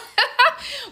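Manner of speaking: laughing at the memory; high laugh. Real, not acted.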